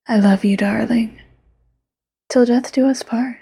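whispered yandere lover. Acted. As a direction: close whisper, sweet and possessive.

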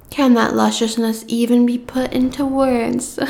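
usual speaking voice

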